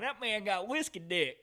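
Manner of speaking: high, slow country drawl; hammed-up country twang, slowed down; country accent. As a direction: imitate a country buddy, drawn out and high. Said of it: "Gavin's pretty country".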